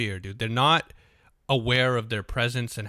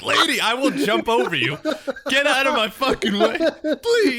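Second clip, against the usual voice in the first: higher, louder, laughing fake shout; mock yell; 11 semitones higher, 6 dB louder than usual. Blocked by an old lady at the chips, laughing mock yell. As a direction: yell at her through laughter, mock fury. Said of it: "it's just like".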